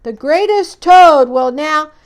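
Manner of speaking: booming showman's announcement; strange far-off voice. Acted. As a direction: announce it grandly from afar. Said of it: "Then the voice said".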